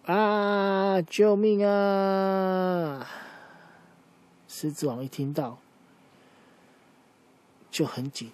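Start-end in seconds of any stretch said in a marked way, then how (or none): 0.0-3.1 high, drawn-out mock scream for help; long stretched-out "救命啊" yelled from afar; acted cry for help. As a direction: wail it out like someone in danger. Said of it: "居然有人在叫".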